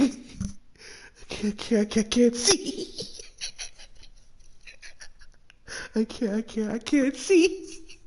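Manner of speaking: squeaky, slow, high laugh-choked words; barely getting words out between laughs; laughing through tears. Real, not acted. Streamer who can't see for laughing, high and strained. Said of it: "I'm fucking crying".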